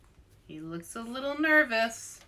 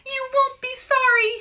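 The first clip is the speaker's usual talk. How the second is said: high, loud call; 8 dB louder than usual. A narrator's reading. Louise running off with the treasure, high loud call. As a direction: call it back over your shoulder, much higher and louder. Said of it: "she called".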